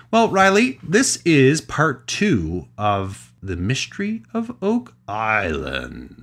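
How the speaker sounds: slow, grand fairy-tale intro; storyteller voice. Acted. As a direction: announce it like an old fireside storyteller. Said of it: "did I sound like the storyteller".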